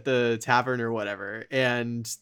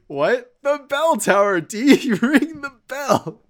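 mock exasperated shout; 10 semitones higher, 6 dB louder, slower than usual. Acted quarrel in castle.

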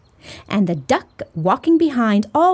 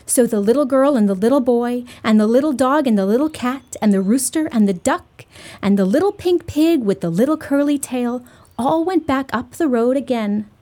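ordinary delivery

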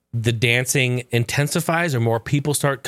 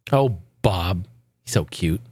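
1 cooing at dog; 7 semitones lower than usual. Real, not acted.

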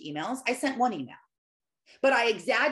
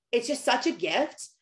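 usual speaking voice

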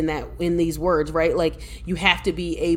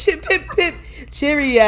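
high, hammed-up British farewell; fake British accent; 8 semitones higher, 3 dB louder than usual. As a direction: back out in a silly British accent. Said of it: "fake it and get out of it".

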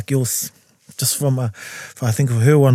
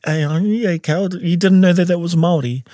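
retold disbelief; 6 semitones higher than usual. Imitates people today reacting to him.

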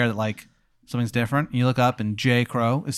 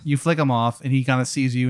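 ordinary talk, nothing marked

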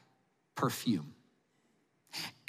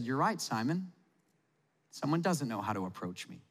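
lower, much softer calm reply; gentle Jesus; 4 semitones lower, 6 dB quieter than usual. Retold or imitated. Jesus calmly answering Simon, lower and much softer. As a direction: turn his words back on him gently. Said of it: "I imagine Jesus would have said".

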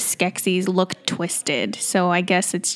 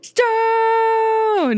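very high, long-held wail of the word; hammed-up creature cry, held long; mock battle cry; 13 semitones higher than usual. Topping the co-host's Skeksis challenge, high mock battle cry.

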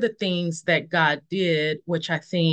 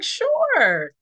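high mock agreement; 13 semitones higher than usual. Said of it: "And I was like".

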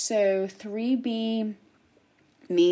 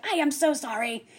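lightly voiced mortified father, higher; apologetic dad; 4 semitones higher than usual. Dad walking in to explain, mildly exasperated apology. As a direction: apologize earnestly on the child's behalf.

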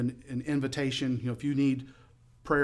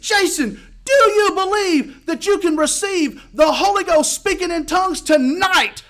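slow, high, full-force shouted challenge; fervent shouting; 12 dB louder, slower than usual. Retold or imitated. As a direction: bellow the question with revival fervor. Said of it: "he got even more excited".

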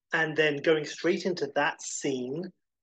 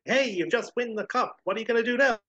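interviewer voice; 9 semitones higher, 4 dB louder than usual. Retold or imitated.

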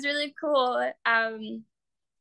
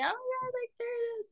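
small high, hushed squeal; quiet excitement; 12 semitones higher, 9 dB quieter than usual. Retold or imitated. Artist recalls seeing her drawing shared, quiet high-pitched excitement. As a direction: retell it tiny, soft and high.

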